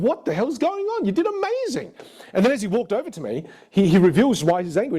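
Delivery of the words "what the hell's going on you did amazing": much higher, pitched up in baffled disbelief. Retold or imitated. Coach to angry student after a great take, baffled and high. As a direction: squeak out your confusion at his anger. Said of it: "drawn into going".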